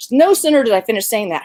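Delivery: ordinary delivery, nothing marked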